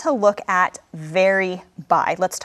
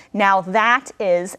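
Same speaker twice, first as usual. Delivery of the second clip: slow, "that" stressed hard; teaching the pattern, stressing "that"; much slower than usual. Shown as a demonstration. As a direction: hit "that" in "now that is". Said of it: "you really have to emphasize the word that".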